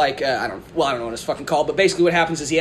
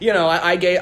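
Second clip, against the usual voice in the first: ordinary talk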